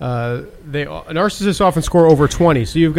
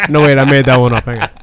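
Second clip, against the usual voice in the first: laughing through words; after laughing hard; 3 semitones lower, faster than usual. Real, not acted. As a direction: giggle out the correction. Giggling while reading.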